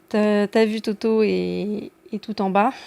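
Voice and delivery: slow, overdone emphatic T in "Toto" and "tout"; hammed-up heavy T on "T'as vu Toto", self-conscious; embarrassed exaggeration. Shown as a demonstration. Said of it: "Au secours, hein, j'ai honte".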